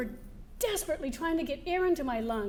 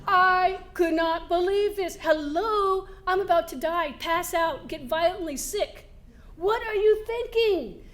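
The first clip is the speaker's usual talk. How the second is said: louder, higher, slower outrage; indignant at the coach; indignant outburst; 5 semitones higher, 6 dB louder than usual. Retold or imitated. Outrage at being pushed uphill.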